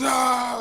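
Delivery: high, drawn-out "aah" scream; mock scream. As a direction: imitate a sudden scream mid-story. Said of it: "just starts screaming".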